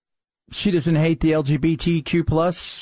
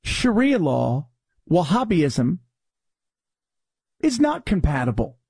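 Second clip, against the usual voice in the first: mocking quote. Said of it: "those of you who are like".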